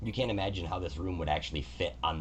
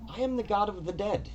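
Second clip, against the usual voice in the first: a higher, measured divine voice greeting the visitor; god Kelemvor; 4 semitones higher than usual. Acted. God Kelemvor greets the adventurer Valk, higher and stately. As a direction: speak as a gracious, orderly god.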